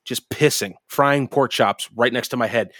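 ordinary delivery

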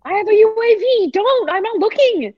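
put-on high defensive kid denial; defensive sibling. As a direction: protest like a kid caught cheating. Acted sibling denying peeking.

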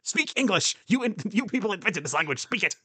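hammed-up high indignant demand; mock exasperated outburst. Joking at the film's Welsh character, mock exasperated outburst. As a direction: play the fed-up viewer scolding the screen, high and mock-angry. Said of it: "I was like".

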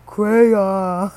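"crayon" stretched out on a monotone, craaay-ooon; laboured pronunciation. Shown as a demonstration. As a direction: drag "crayon" out as if it's a chore. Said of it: "saying crayon to me is such a freaking chore".

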